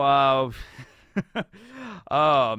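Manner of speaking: amused laugh in a put-on voice; Morgan laughing. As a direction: laugh off the kid's naivety. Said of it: "And Morgan is like".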